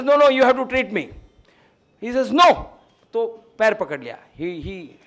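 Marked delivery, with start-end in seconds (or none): 0.0-1.1 louder, higher pleading insistence; insistent plea. Retold or imitated. Patient pleading with the departing healer, loud and insistent. 2.3-2.7 loud, high, sharp refusal. Retold or imitated. Healer shutting down the begging patient, a loud sharp no.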